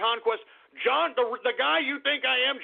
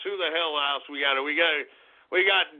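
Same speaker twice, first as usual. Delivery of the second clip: ordinary talk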